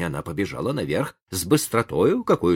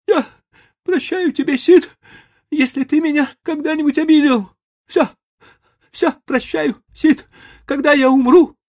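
very high, loud mock-tragic farewell; melodramatic deathbed; 8 dB louder than usual. A narrator's reading. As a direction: play the dying hero. Tom plays deathbed farewell.

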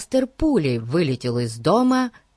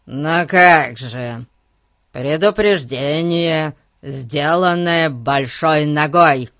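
loud, slow, emphatic explanation; drawn-out, forceful insistence; insistent explaining; 6 dB louder, slower than usual. Acted. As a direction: spell it out loudly for someone slow. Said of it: "растолковал ему Гун".

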